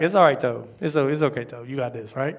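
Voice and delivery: low, easy reassurance, softening at the end; God reassuring. Retold or imitated. God comforting a worried preacher, low and reassuring. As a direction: console gently, voice dropping away. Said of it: "That's how he talks".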